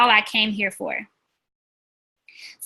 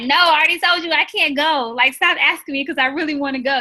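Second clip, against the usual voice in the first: higher and louder, fed-up pushback; exasperated refusal; 5 semitones higher, 3 dB louder than usual. Retold or imitated. To a friend who keeps asking her to go, exasperated raised refusal. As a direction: snap back at a friend who won't quit asking. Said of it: "And I'm like".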